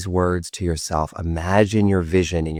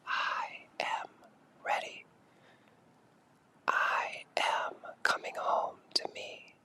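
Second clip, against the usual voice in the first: barely audible, slow whisper; softer whisper; 16 dB quieter, slower than usual. Shown as a demonstration. Guide hushes the affirmations even softer. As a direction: let it fade almost to nothing. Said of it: "let it get softer even".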